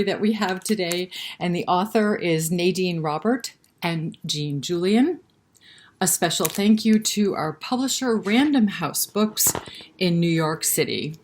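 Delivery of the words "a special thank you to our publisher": ordinary delivery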